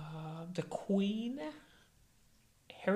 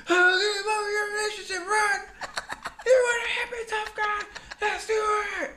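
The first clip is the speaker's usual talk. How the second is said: higher, louder, hammed-up yelling; mock reality TV shout; 7 dB louder than usual. Imitating a reality TV star yelling at Ron, loud and high. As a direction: rant like a hothead on reality TV.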